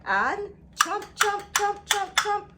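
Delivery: high, loud playful chant of chomps; lively sing-song chomps; excited chomping. Acted. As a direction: bounce each chomp for the children.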